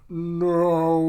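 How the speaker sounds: long, loud movie-villain no; Darth Vader no. Retold or imitated. Imitating Vader's despair for Crone's doom, loud and long. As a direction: bellow it like Vader's famous scream. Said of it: "I just picture Darth Vader".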